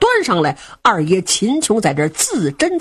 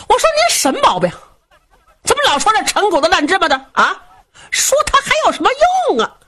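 fast and much higher, whining with irritation; exasperated son; 11 semitones higher, faster than usual. Acted. Son cutting off his father's memories, exasperated. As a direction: snap back, fed up with old stories.

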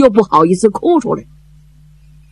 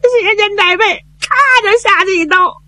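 crying report; faster than usual. Acted. Messenger reports uncle's death, crying.

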